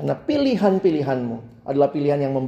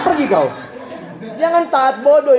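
mock outrage; 8 semitones higher than usual. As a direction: shoo them away. Preacher exclaims at absurd parent. (usiran pura-pura marah, lebih keras)

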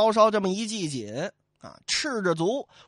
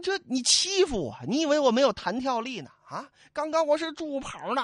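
higher, fast blustering protest; indignant Li Kui; 7 semitones higher than usual. Acted. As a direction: bluster to save face. Li Kui blusters after being hit. (李逵不服气地嚷嚷)

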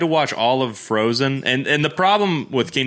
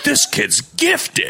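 impressed announcer; slower than usual. Retold or imitated. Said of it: "And they're like".

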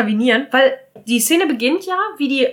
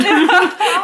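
high quick giggle; real amused giggle; short high laugh at the joke; 7 semitones higher, 3 dB louder than usual. Real, not acted. Laughs at her own quip.

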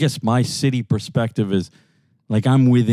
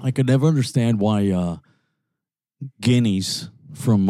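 usual speaking voice

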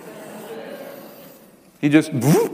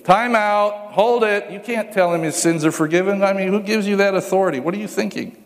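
indignant leaders; 6 semitones higher than usual. Retold or imitated. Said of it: "the religious leaders".

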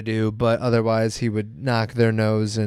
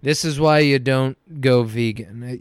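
ordinary talk

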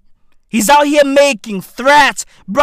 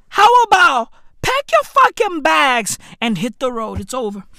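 ordinary talk, nothing marked

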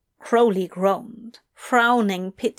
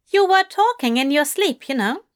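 higher, fussy angelic voice; Aziraphale voice; 6 semitones higher, 3 dB louder than usual. A narrator's reading.

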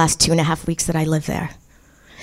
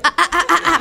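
scared yelps; 8 semitones higher, faster than usual. Retold or imitated. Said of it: "You're like".

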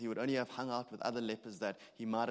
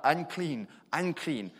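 loud, falling calls of unclean; crying out a warning; 4 semitones higher, 7 dB louder, much slower than usual. Retold or imitated. Leper warning passers-by to keep away, loud cry. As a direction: shout it so people keep away. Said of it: "you have to cry out".

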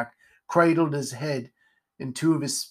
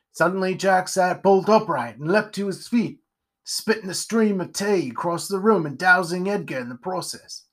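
ordinary delivery, nothing marked

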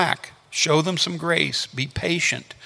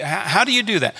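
ordinary delivery

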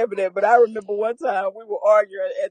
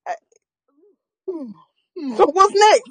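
ordinary talk, nothing marked

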